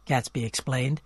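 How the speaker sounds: ordinary delivery, nothing marked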